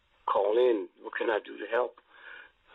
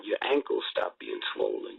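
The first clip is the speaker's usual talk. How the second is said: normal talking voice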